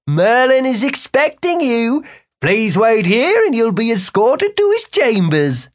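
high-voiced guard receiving visitors; formal palace guard. A narrator's reading. Guard to wizards at the arch, formal.

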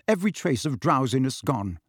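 ordinary delivery

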